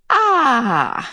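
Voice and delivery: high, pleased ah; old woman sigh of relief. Acted. Mrs Watson relieved of her boot, high pleased ah. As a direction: let out a satisfied ah.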